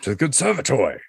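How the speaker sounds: hammy Victorian gent echo; mock Victorian toast; victorian gentleman. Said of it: "in the most Victorian voice he can do".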